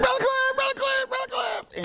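very high mock wail; screechy, hammed-up crying cry for help; imitating bawling woman. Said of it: "crying, bawling, screaming".

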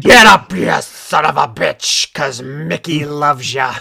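loud gruff trainer yell; Mickey from Rocky. Retold or imitated. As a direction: bellow it like an old boxing coach.

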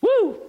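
high, loud sarcastic woo; mock party whoop. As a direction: cheer like a fake party. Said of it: "That sounds like a party".

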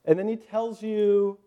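ordinary delivery

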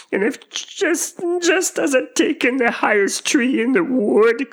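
high, loud pigeon screech; rising to a shriek. A narrator's reading. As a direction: build the complaint toward shrieking. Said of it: "raising its voice to a shriek".

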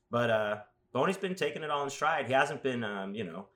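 ordinary talk

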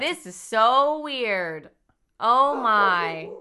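slow, overdone fake surprise; hammed-up fake worry. Killer fakes confusion at the bar, hamming it. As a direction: drag it out like bad acting. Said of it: "my acting here".

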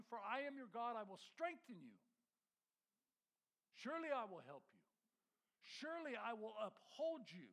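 preaching at full force. Acted. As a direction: thunder the promise out to the congregation. Preaching Isaiah's words with rising force.

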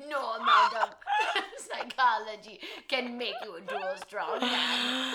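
slow, loud laughing muscleman; strongman. Acted. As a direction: boom it through laughter. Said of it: "strongman voice".

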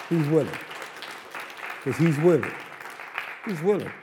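punched, loud repeated declaration; forceful preaching repetition. Real, not acted. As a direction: declare it to the room. Preacher declares God's will.

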